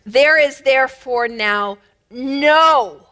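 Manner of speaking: "no" held long and slow, pushed hard; slow, emphatic "no"; stress on no. Shown as a demonstration. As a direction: lean on "no" with full weight. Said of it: "the no is emphatic".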